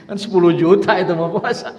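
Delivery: breathy laugh into a loud punchline; laughing at his own joke. Real, not acted. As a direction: laugh through the joke, then push it out. Joke about ten children.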